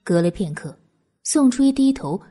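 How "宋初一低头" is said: ordinary delivery, nothing marked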